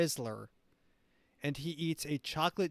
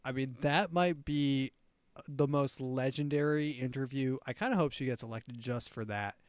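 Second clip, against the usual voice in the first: ordinary talk, nothing marked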